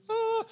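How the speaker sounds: tiny high-pitched yelp; mock frightened squeal. Driver nearly hit by a cop, mock squeal. Reaction to near crash.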